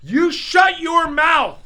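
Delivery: high loud slow playful yell; mock angry shout. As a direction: shout it in fake outrage. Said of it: "I moved away from the mic".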